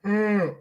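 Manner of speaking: a high, drawn-out yawn. Real, not acted. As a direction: let a long yawn out. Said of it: "why am I yawning".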